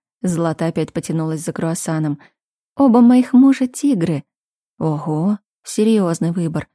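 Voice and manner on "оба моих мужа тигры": lightly voiced proud girl. A narrator's reading.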